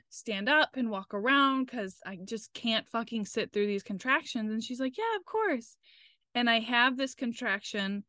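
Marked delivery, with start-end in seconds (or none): 5.0-5.8 very high, light mock agreement; imitated obliging nurse. Imitating the nurse letting a patient walk around, high obliging voice. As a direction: lift it way up, accommodating.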